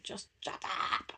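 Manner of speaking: whispered, slow "just shut up"; muttered aside. Retold or imitated. Mum at a meltdown, whispered and slow. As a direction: breathe it out under the breath. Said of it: "I'm like".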